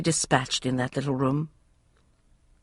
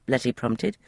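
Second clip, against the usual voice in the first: plain reading voice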